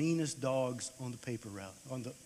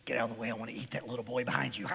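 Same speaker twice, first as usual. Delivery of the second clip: lower, menacing voiced dog; snarling dog; 4 semitones lower, 4 dB quieter, much faster than usual. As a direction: growl it out like a hungry dog. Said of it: "Here come the dogs, snarling".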